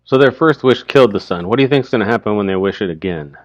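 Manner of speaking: plain reading voice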